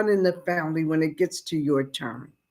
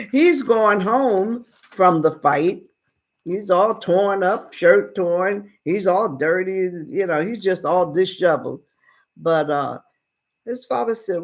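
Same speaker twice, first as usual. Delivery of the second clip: normal talking voice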